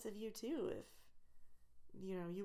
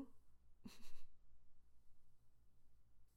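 soft breathy laugh; 5 dB quieter than usual. Real, not acted.

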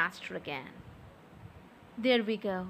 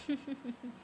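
soft laugh; 10 dB quieter than usual. Acted. Amused he can't say yes.

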